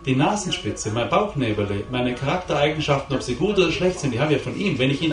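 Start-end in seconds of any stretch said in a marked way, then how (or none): none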